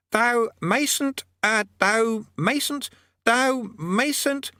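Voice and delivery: high, faltering mock-solemn voice; giving up mid-pronouncement; floundering archaic command. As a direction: fumble the old-fashioned phrasing. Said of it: "gave up".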